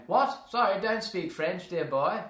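high, loud caricature British officer; British airman accent. Acted. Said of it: "scenes with the British, airmen".

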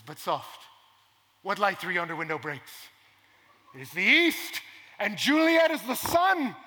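building to loud, high declamation then easing off slowly; reciting Shakespeare. Retold or imitated. Romeo beneath Juliet's window, loud rising recitation. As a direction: swell into the balcony speech like a stage Romeo. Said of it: "and says".

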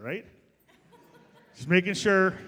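normal talking voice

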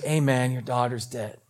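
low, soft and subdued; subdued bad news. Servants telling Jairus his daughter died, subdued. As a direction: break the news quietly.

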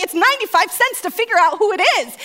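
high, loud, rapid defense; frantic justification. Acted. Wife justifying the spend to husband, frantic and fast. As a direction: argue it urgently at top pitch.

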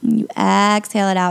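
calm cue, then an audible breathy exhale; breathing demo. Shown as a demonstration. Yoga teacher demonstrating Ujjayi breath on the podcast, calm and breathy. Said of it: "I'll try to, to demonstrate it here".

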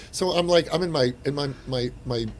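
normal talking voice

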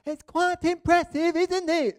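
high, loud shout over roaring water; straining to be heard over the falls; shouting over a waterfall. Retold or imitated. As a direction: yell it over the thunder of the falls.

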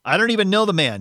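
high, loud, vehement outburst; emotional denial. A narrator's reading. Peter denying Jesus with an oath, vehement. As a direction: deny it forcefully, voice jumping up. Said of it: "there was a lot of emotion in him".

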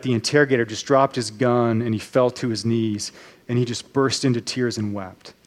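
ordinary talk

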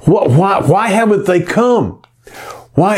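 high, indignant questioning; mock outrage, voice up high; irate demand. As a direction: demand it, scandalised on her behalf. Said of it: "he grew very, very irate".

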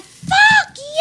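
high, loud mock scream; imitated screaming. Delivery sister screaming at her sister, loud high shriek.

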